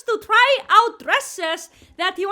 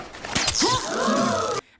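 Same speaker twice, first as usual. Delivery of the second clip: sharp shocked gasp, pitched up; 5 semitones higher than usual. Real, not acted. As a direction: gasp as if caught off guard. Reacting to scene in movie.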